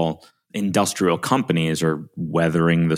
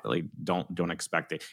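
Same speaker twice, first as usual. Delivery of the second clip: much softer, almost under the breath; muttered aside; 10 dB quieter than usual. Real, not acted. Warning host about silly pitches, much softer aside.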